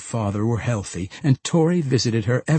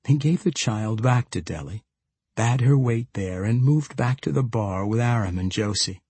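usual speaking voice

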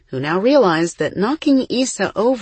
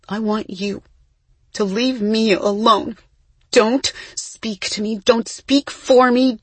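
measured start, building higher and louder; controlled anger; 5 semitones higher, 3 dB louder than usual. A narrator's reading. Isa cutting off her twin, controlled anger building. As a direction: lay down each order deliberately, intensifying. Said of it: "Isa's voice lowered".

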